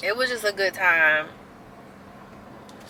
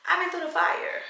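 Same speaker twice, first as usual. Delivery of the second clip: much higher, dramatic echo; high emphatic repeat; 11 semitones higher than usual. Real, not acted.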